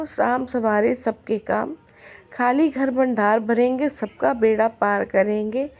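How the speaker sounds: plain reading voice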